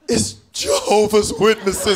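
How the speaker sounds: mock tearful dread, slow; hammed-up terrified mother; slow mock-tragic reveal to her kid; tearful mom. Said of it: "a tear falls, and she goes".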